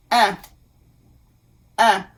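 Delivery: "Un" said as a French nasal vowel through the nose; nasal un sound. Shown as a demonstration. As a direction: model "Un" slowly, a nasal vowel. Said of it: "ways to write the sound un".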